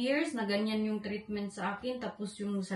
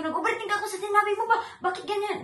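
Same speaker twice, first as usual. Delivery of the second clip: high, loud, fast mock whine with a laugh; mock husband's scornful reply; 10 semitones higher, 5 dB louder, faster than usual. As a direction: ham up his sneering reply, laughing. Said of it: "hindi ako nagsigaw".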